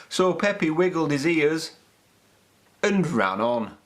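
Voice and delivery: ordinary delivery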